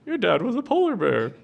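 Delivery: high, patient puppet mother voice; mother polar bear. Acted. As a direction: reassure the cub in a high puppet voice. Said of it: "And the mom goes".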